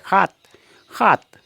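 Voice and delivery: हाथ said high, the s turned into h; Assamese pronunciation. Shown as a demonstration. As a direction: punch हाथ with a breathy h start. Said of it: "हम इसको हाथ कहेंगे". (असमिया उच्चारण हाथ, ऊँचे स्वर में)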